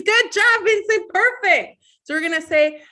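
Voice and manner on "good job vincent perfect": high, loud burst of praise; excited praise. Real, not acted. Praises a child's right answer.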